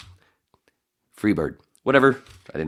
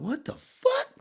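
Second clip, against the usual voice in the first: very high, soft squeak of disbelief; a tiny stunned squeak; high quiet squeak of confusion; shocked; 4 dB quieter, slower than usual. Retold or imitated.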